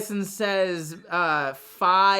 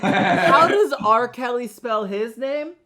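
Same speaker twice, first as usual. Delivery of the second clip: mock clerk; 3 semitones higher, slower than usual. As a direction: play the clerk teasing him, drawn out and laughing. Mocking a clerk's question.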